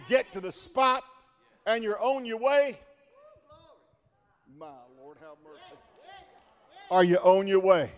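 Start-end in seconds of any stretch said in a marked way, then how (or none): 4.6-5.8 murmured prayer. Real, not acted. Preacher murmurs a prayer aside.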